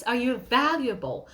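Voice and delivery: usual speaking voice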